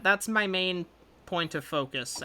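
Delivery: normal talking voice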